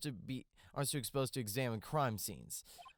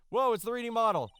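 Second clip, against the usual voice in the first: excited; 9 semitones higher, 8 dB louder than usual. Real, not acted. Reacts to 3D model.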